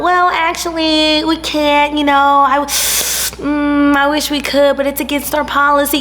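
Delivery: high, prissy fake-polite voice; mock a fussy rule-follower; hammed-up snooty receptionist; snooty gatekeeper. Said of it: "old hating ass Sally Mae".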